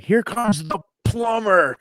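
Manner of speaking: high, slow, drawn-out announcer call; mock wrestling announcer. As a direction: hype the entrance like a ring announcer. Mock announcer character intro.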